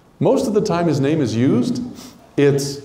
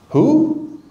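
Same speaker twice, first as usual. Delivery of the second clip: confused who; 12 semitones higher than usual. Acted. Voicing confused listener again.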